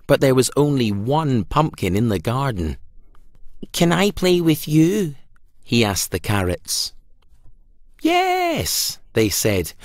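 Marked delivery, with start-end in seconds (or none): 3.7-5.1 lightly higher, small hopeful pumpkin; a light hint of a hopeful pumpkin; Pumpkin's voice. A narrator's reading. Pumpkin asks the carrots. 8.0-9.0 much higher squeaky vegetable yes; tiny high carrot voice; carrots' voice. A narrator's reading. Carrots answer Pumpkin.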